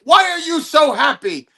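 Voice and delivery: high-pitched, exasperated impression; insistent caller. Caller grills a puzzled comic, imitated insistence. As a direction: press the question, pitched well up.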